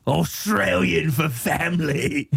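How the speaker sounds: high, slow, drawn-out Aussie ad parody; Australian commercial voiceover. Acted. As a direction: stretch each slogan in a hammed-up Australian accent. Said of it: "voiceover for an Outback Steakhouse commercial".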